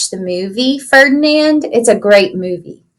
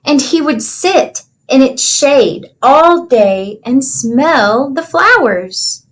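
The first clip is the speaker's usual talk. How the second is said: storybook reading. Acted. Teacher reads picture book aloud.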